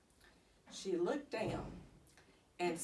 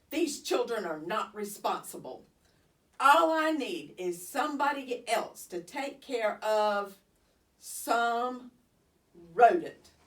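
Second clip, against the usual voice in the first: RODENT spat out much louder at the end; disgusted rodent; 5 dB louder than usual. Acted. Teacher complaining about the hamster, RODENT spat loud. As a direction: sneer the word rodent hard. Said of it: "Nobody says rodent quite the way".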